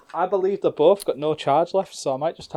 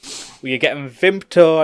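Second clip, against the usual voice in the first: dad laying down the law; 3 semitones higher, 8 dB louder, slower than usual. Retold or imitated. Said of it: "he'd be like".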